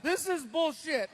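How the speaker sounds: mock the teenage son sulking in the car; sulky son. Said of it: "He's like".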